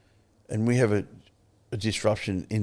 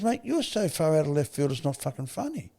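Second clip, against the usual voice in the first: higher-pitched blunt telling-off; retold straight talk; 6 semitones higher than usual. Advisor setting his mate straight, mildly exasperated. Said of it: "gives it to you fucking straight".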